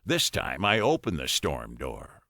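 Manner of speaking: ordinary delivery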